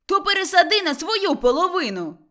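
high, loud retort; irritated shout. Acted. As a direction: shout back to end the argument. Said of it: "крикнув Лаврін". (роздратований вигук)

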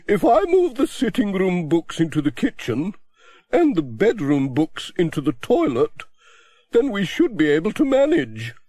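a chatty spinster complaining cheerfully; voiced Miss Hubbard.

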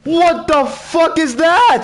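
high, loud, slow scared yell; terrified shout. Real, not acted. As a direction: shout in sheer fright. Sees a monster.

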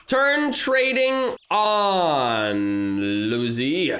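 drawn out. Real, not acted. Streamer drawing out a plea to turn trading on. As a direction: stretch it out slowly, almost pleading. Streamer asks player to trade.

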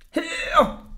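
high-pitched call after someone; alarmed shout. A narrator's reading. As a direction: yell to stop someone leaving. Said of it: "råbte Orla".

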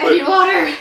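urgent call. Real, not acted. Burning mouth from hot wings.

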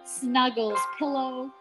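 ordinary delivery, nothing marked

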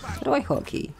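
ordinary talk

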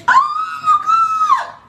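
high shouted oh my God; laughing shout. Real, not acted. Pastor retelling [name]'s joke, shouting through laughter. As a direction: cry it out, helpless with laughter.

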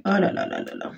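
breathy, soft ah là là under the breath; a soft weary groan of frustration; exasperated sigh. Real, not acted. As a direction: sigh it out, fed up with the tape. Struggling with sticky tape.

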